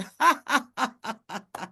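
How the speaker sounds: high sly cackling laugh; sly cackle. Acted. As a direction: cackle like a trickster spider. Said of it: "And he laughed".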